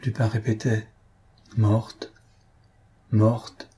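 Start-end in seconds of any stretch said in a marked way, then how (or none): 1.6-3.7 thoughtful, uncomprehending. A narrator's reading. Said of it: "d'un ton réfléchi comme s'il ne comprenait pas encore".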